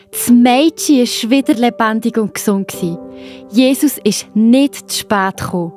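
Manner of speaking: usual speaking voice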